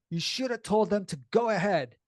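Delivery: mom mildly angry. Retold or imitated. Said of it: "my mom was really mad".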